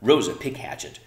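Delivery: usual speaking voice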